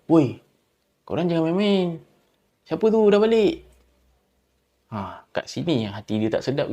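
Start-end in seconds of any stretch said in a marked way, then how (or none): 0.1-3.7 loud, higher, annoyed shout; angry yell. Retold or imitated. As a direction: warn the friends to stop joking. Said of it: "Lepas tu dia jerit lagi". (berteriak marah)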